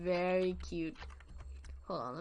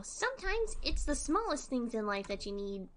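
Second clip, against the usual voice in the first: usual speaking voice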